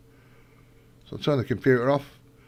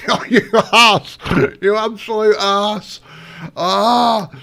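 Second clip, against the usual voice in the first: loud, high exasperated yelling; 8 semitones higher, 8 dB louder, slower than usual. Real, not acted. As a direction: yell at your own blunder. Game mishap, yelling at self.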